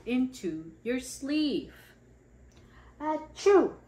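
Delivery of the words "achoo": loud acted sneeze; big pretend sneeze. Acting out sneeze in story.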